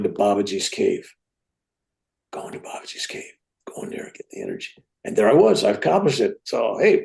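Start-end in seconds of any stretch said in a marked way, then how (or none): 2.3-4.7 soft unvoiced whisper, almost a mantra. Real, not acted. Man recalling his hospital visualization, whispered. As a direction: breathe the words like an inner vision.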